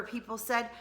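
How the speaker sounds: ordinary delivery, nothing marked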